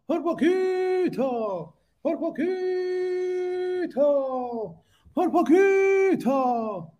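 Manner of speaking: high, drawn-out mocking "por poquito" in a borrowed voice; Hugo Sabinovich impression. Streamer imitates a famous commentator, high and drawn-out. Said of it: "como diría el gran, eh, Hugo Sabinovich".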